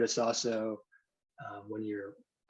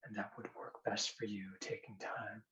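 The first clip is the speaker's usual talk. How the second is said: hypnosis induction; 6 dB quieter than usual. Acted. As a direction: guide them gently into trance.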